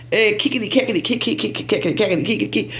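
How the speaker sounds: rapid nonsense syllables mimicking laughing friends; mock gabbing and giggling in choppy syllables; imitated cackling chatter. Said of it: "laughing and talking and saying".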